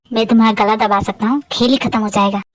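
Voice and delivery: a captive threatening his captor; low menacing threat. Acted.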